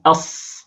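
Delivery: أص whispered, no voice in the s; voiceless sad. Shown as a demonstration. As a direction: hiss أص. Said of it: "لأن الصاد مهموسة".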